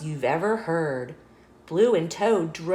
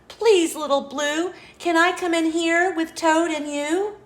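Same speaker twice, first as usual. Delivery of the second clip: higher, pleading little goat; 10 semitones higher than usual. A narrator's reading. Goat begging Little Blue for shelter, higher pleading. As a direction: ask sweetly as the goat.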